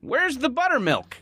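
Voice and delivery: put-on high, quavering old man; funny old man voice. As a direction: squeak it out like a cranky old man. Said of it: "I can do a funny old man voice".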